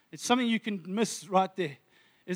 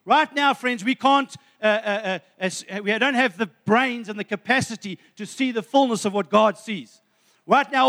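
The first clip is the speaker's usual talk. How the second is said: louder, rising preacher's build; fervent preaching; 4 dB louder than usual. Acted. Pastor urging his friends in church, fervent preaching. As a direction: preach it to the congregation with conviction.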